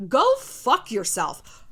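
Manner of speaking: each word spelled out slowly; slow deliberate curse. Real, not acted. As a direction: say "Go fuck yourself" slowly, drawn out. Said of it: "righteous fire in your soul".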